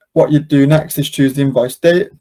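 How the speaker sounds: usual speaking voice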